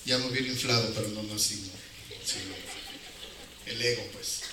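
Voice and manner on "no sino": usual speaking voice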